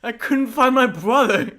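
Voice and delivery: slow, loud, high pretend wail; hammed-up anguish; mock wailing. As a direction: tease with a drawn-out distraught cry. Mock wail teasing the storyteller.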